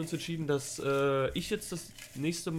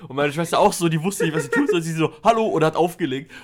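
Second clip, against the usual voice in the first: louder excited telling; 3 semitones higher, 8 dB louder than usual. Real, not acted. Excited climax of story.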